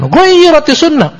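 high-pitched imitation of protesters crying out; retold outcry. Preacher imitating people objecting to the true sunnah, high-pitched outcry. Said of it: "manusia pun berteriak mengatakan".

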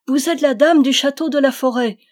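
the knight kneeling, crying out to the princess; exclaiming. A narrator's reading. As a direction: cry it out in sudden realisation. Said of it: "s'écria-t-il".

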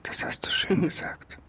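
tonloses Flüstern, kurz auflachend; amüsiert zurückflüstern; geflüstert mit Lachen. Acted. Whispered reply with laugh. (flüsternd, lachend)

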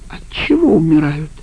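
ordinary delivery

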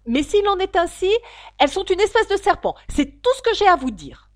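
high, loud, sharp questioning; suspicious pigeon. Acted. Pigeon interrogating Alice, high and suspicious. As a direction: demand it like a distrustful bird.

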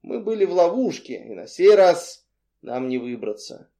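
ordinary delivery

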